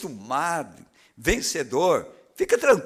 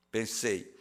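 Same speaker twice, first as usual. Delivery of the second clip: ordinary talk, nothing marked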